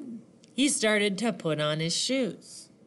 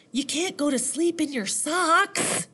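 higher-pitched mom objecting; lightly voiced motherly scolding; storybook mom; 6 semitones higher than usual. A narrator's reading. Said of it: "said his mom".

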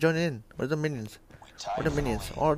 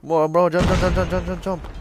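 loud, high, urgent repeated shouts; frantic yelling; 4 semitones higher, 6 dB louder than usual. Real, not acted. Gamer yelling at his character to jump, frantic loud shouts. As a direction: yell it like your character's life depends on it.

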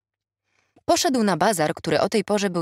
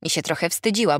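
ordinary delivery

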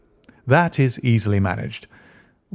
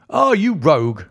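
delighted; 7 semitones higher, 4 dB louder than usual. A narrator's reading. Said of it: "highly delighted".

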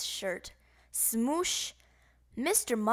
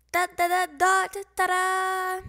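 loud sung bugle blast; mock bugle call; 8 semitones higher, 7 dB louder than usual. Bugle waking the camp, loud sung bugle call. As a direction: trumpet it like a reveille bugle.

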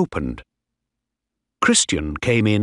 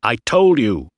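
loud, impatient insistence; shouted; 5 dB louder than usual. A narrator's reading. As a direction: insist with a raised voice. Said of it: "he shouted".

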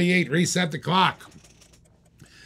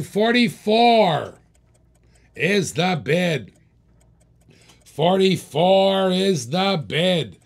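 dramatic auctioneer announcement; drawn-out bid call; 2 semitones higher, 3 dB louder, much slower than usual. Real, not acted. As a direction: stretch the number out for the crowd.

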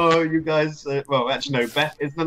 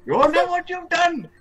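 retold angry caller; 3 dB louder, slower than usual. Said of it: "he just goes like".

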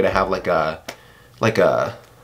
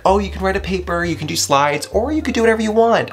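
much higher, breezy teacher voice; mocking professor; 10 semitones higher than usual. Student imitating a professor's vague assignment, high mocking voice. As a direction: send up a vague professor, pitched way up. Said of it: "where they're like".

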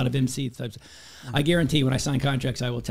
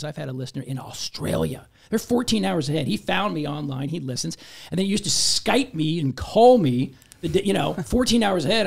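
ordinary talk

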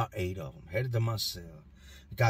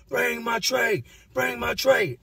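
high pitched demanding yell; 10 semitones higher, slower than usual. Retold or imitated. Inmate yelling at his cell door for food. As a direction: bark it at the door. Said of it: "I yell at the door".

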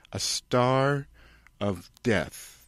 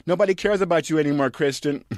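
mock scolding; 8 semitones higher, 5 dB louder than usual. [name] fast loud retort.

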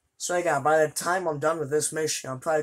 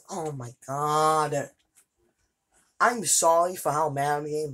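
normal talking voice

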